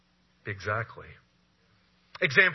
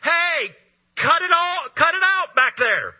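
loud, high shout from the shore; commanding call from shore to boat; Jesus shouting across the water; 14 semitones higher, 8 dB louder than usual. Acted. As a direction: yell it out so it carries over the waves. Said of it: "from the shore go".

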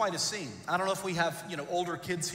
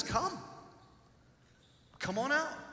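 gentle invitation; 8 semitones higher, 3 dB quieter than usual. Retold or imitated. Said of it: "Jesus immediately says".

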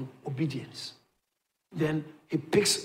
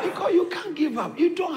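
much higher shout through laughter; laughing shout; 4 dB louder than usual. Real, not acted. Preacher shouts while laughing.

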